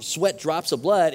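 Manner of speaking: normal talking voice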